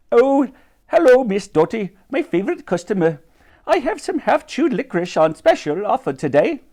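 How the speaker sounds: high-pitched, eager shopkeeper voice; Raj. A narrator's reading. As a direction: greet a favourite customer and pitch a bargain. Raj the sweet seller greets his customer.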